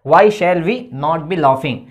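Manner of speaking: every word drawn out slowly for writing down; very slow dictation. Shown as a demonstration. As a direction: read it very slowly so students can copy. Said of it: "dictating the full sentence". (बहुत धीरे-धीरे लिखवाते हुए)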